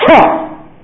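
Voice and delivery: "Ha!" punched high and loud with a strong breathy H; sharp H sound. Shown as a demonstration. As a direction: blast out "Ha!" as a rough breathing. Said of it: "The H sound".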